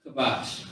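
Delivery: "kabash" with a light, simple k; unforced K. Shown as a demonstration. Teacher contrasting Hebrew words, "kabash" unforced. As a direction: say "kabash" without force in the throat. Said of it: "not really saying it with too much force".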